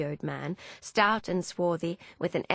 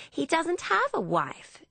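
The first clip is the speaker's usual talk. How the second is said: high, drawn-out protest; indignant defence; 12 semitones higher than usual. Acted. Emmeline defending Philippe to Hannah, protesting. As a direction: leap to his defence, pitched way up.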